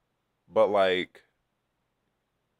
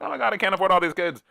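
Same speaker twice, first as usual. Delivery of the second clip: high, fast, louder whiny complaint; mocking parents who can't afford their kids; mock frazzled parent; 11 semitones higher, 5 dB louder, faster than usual. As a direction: whine like a broke parent, hammed up. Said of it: "they're like".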